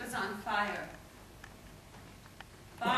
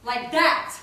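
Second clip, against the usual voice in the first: building from loud to a high rising cry; insistent, escalating; 5 semitones higher, 11 dB louder than usual. Acted. Poet demanding the audience see her face, insistent. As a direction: hit THAT harder the second time.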